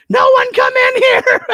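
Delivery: high, loud panicked yell. Retold or imitated. Dog owner yelling to family to stay out, panicked. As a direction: yell to keep everyone out. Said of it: "And I just yelled".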